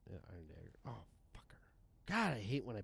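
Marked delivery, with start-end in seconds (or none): 0.1-1.6 off-mic mutter. Real, not acted. Mic pushed away, gaming.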